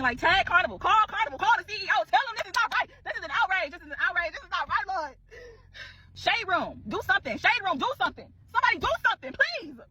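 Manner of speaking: high, loud dramatic plea; mock outrage. Real, not acted. Begging the Shade Room and viewers to act, hammed-up outrage. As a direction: wail it like a public appeal.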